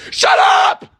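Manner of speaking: a full-throated high screaming shout; furious shout. Retold or imitated. Yelling at chatty moviegoer.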